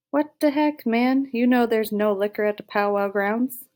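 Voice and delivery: loud, high-pitched challenge; indignant confrontation. Acted. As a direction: call him out loudly, incredulous. Giant confronts Carl over liquor.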